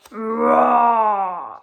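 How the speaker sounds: big roaring shout on the word; full-throated lion roar. A narrator's reading. Narrator roars the lion's roar.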